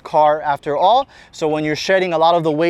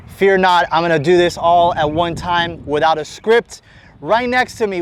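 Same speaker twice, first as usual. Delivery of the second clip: ordinary delivery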